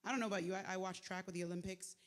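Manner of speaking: normal talking voice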